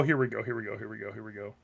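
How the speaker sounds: volume ducking suddenly; level pumped down by the mic; auto gain riding. Real, not acted. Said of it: "automatic gain control".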